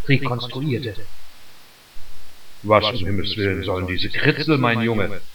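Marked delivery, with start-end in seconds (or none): 2.6-5.2 a hint of an older gentleman, lower and a bit quick; lightly lowered, quicker older man's voice; old gentleman asking. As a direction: lightly voice the puzzled old councillor. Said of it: "fragte er".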